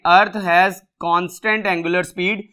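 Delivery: each word spaced out for note-taking; slow, deliberate classroom dictation. Shown as a demonstration. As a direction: read it out slowly like dictation. Reads statement for notes.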